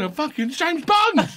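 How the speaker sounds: high, slow, drawn-out exclaim; mock outrage. Retelling the Bond fight to the podcast host, high and drawn out. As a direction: wail it in disbelief.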